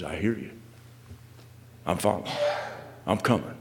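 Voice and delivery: low, soft follower's answer; meek reply. Acted. Follower's submissive answer, acted.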